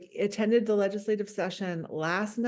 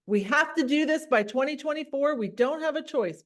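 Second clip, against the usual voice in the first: higher, insistent push; direct voice; 7 semitones higher, 3 dB louder than usual. Shown as a demonstration. Board member insisting on the deadline, direct voice. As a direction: press the point, pitched up. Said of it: "You have to use that direct voice".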